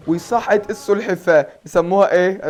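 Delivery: loud, slow, thrilled reveal; excited announcement. Real, not acted. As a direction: announce the wake-up with delight. Turtle suddenly wakes up. (بحماس وصوت عالي)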